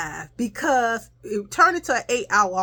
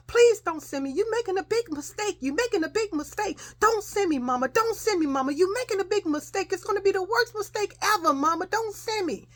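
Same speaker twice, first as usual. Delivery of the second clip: imitated screaming and hollering; 10 semitones higher than usual. Child on the floor begging mama not to send her, mock hollering. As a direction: re-enact a child's wailing tantrum, plea after plea. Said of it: "screaming and hollering".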